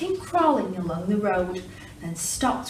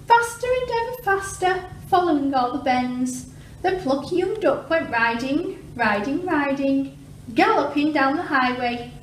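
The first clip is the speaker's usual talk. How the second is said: higher, louder racing read; galloping excitement; 6 semitones higher, 3 dB louder than usual. A narrator's reading. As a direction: build the gallop.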